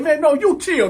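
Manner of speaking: high-pitched, heated comeback; defiant retort. Retold or imitated. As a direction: throw it back at him. Retold retort before punching.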